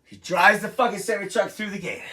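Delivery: ordinary talk, nothing marked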